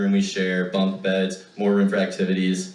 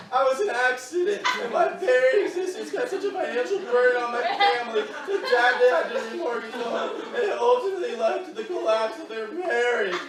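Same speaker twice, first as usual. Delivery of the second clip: strained forced recital; 12 semitones higher than usual. Retold or imitated. Forced recitation while pinned.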